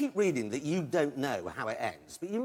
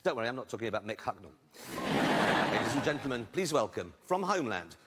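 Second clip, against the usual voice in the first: ordinary talk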